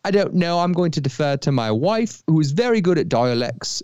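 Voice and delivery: playful Cockney-ish voice; put-on Cockney-like accent. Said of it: "You sometimes turn Cockney".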